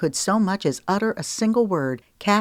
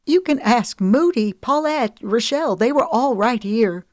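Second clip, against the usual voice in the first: higher, louder, rushed and distressed; upset pleading; 7 semitones higher, 5 dB louder than usual. Acted. Woman begging her lover to believe her, higher and distressed.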